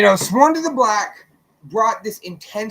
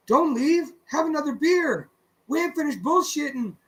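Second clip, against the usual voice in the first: lightly voiced drunken uncle, higher pitched; drunken Mexican Theo; 7 semitones higher than usual. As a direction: coax a guest to stay, a hint of tipsy uncle. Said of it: "drunken Mexican Theo".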